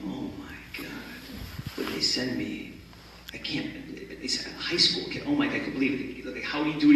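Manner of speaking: softly whispered shock at the rookie lawyer; whispered disbelief. Retold or imitated. Joe sees the young replacement lawyer, whispering in shock. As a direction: breathe it out, stunned.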